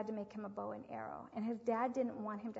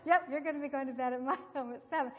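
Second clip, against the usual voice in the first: laughing, louder confirmation; laughing yep; 3 semitones higher, 5 dB louder than usual. Real, not acted. Laughing back at little Danny, cheerful and firm. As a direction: chuckle through the answer.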